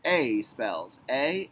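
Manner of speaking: drawn-out letter naming; slow spelling out. Shown as a demonstration. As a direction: spell it slowly for children. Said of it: "A is a basketball word".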